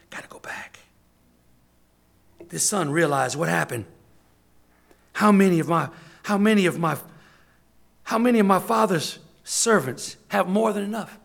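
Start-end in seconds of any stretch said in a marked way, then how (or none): 0.1-0.8 urgent whisper to himself. Acted. The lost son telling himself to turn back, whispered. 5.1-11.2 loud, searching cry; son's realization. Acted. The son comparing himself to his father's servants, loud lament.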